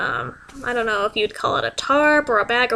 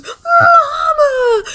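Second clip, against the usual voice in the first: high, wailing cry of Mama; hammed-up wail for attention; dramatic scream; 4 dB louder than usual. Aunt at the burial, a melodramatic wailing cry.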